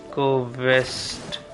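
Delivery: ordinary talk, nothing marked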